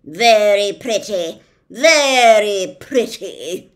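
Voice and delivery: loud, slow sarcastic scowl. Acted. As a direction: sneer it out while glaring. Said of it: "he scowled".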